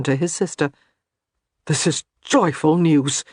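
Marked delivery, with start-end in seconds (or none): 1.7-3.2 voice shaking with emotion. A narrator's reading. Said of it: "His voice shook with emotion".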